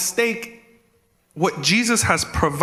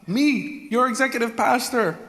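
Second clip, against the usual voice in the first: pitched-up jokey jab at himself; mock high self-jab; 9 semitones higher than usual. Real, not acted. Pastor teasing himself to the church, pitched up high. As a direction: squeak out the title in self-mockery.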